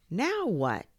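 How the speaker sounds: fed-up outburst; exasperated. Acted. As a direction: demand it at wits' end. Boy exasperated yet again.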